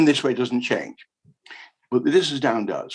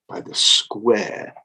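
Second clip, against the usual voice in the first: usual speaking voice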